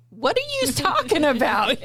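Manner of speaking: high-pitched, incredulous family member; exaggerated "what on earth?" reaction; mock bewilderment. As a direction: imitate a baffled relative, pitch jumping up. Family's reaction to her borrowed accent.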